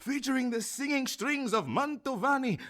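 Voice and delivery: fast-talking sideshow huckster selling records; a hammed-up barker's spiel, swelling to a big finish; carnival barker pitch. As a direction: hawk it like a fairground barker.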